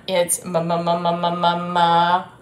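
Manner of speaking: low repeated ma sounds; sounding out m. Shown as a demonstration. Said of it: "starts with the letter M".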